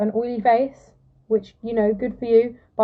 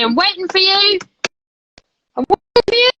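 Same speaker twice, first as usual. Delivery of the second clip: high sing-out, climbing higher on the repeat; playful calling out; 7 semitones higher, 4 dB louder than usual. Pretend. Host waiting for a guest to join the live, playful high call. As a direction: call playfully to someone who is late, pitched way up.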